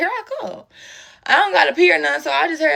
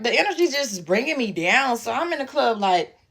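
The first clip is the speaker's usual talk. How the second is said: ordinary delivery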